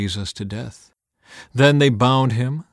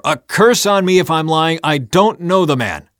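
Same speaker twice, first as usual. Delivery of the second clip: loud, higher, vehement denial; an oath sworn in a cornered panic; swearing an oath; 7 semitones higher, 8 dB louder than usual. A narrator's reading. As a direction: swear it forcefully, denying everything. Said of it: "Peter swore".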